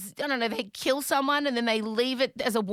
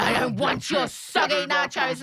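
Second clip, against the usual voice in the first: petulant refusal; 5 semitones higher, 5 dB louder than usual. Retold or imitated. Mother refusing soggy nachos.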